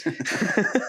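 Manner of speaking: high teasing accusation; mock-accuse him, playfully. Teasing accusation after the joke.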